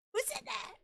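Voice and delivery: very high, thin who said that; squeaky mock fright. Scared of the invisible voice, squeaking who said that. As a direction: squeak it in fake terror.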